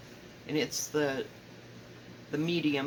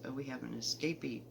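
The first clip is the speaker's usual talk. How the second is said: ordinary delivery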